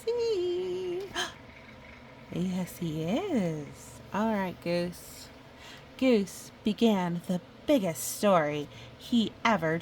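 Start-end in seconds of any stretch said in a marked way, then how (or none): none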